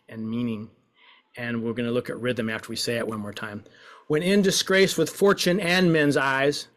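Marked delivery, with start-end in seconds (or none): 4.1-6.7 expressive woeful reading. Shown as a demonstration. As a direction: give it full woe. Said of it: "we're playing with expression".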